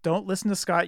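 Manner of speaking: normal talking voice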